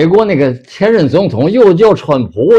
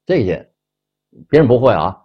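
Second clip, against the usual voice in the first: ordinary talk, nothing marked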